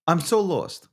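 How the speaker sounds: normal talking voice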